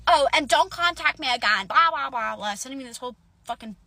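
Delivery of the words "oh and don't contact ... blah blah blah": higher, louder mock-bossy quote; mocking imitation. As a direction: parrot the text dismissively. Mocking the roommate's text.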